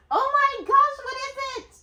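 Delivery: very high astonished squeal; high-pitched wonder at a surprise gift; amazed. Real, not acted. Startled by what she unwraps.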